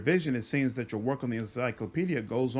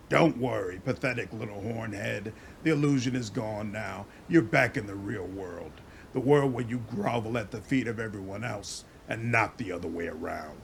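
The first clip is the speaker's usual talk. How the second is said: low, scornful sneer; contemptuous; 6 semitones lower than usual. Acted. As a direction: look down on him. Sneers at dreaming companion.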